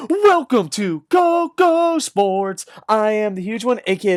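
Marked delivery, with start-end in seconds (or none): 0.1-2.6 slow, high, stretched-out welcome; show intro. Acted. Podcast host greeting his listeners, drawn-out excited welcome.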